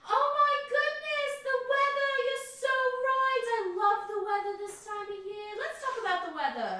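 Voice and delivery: loud, high, over-the-top enthusiasm; mock gushing. Day butting into a stranger's chat, loudly gushing. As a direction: ham up delight about the weather.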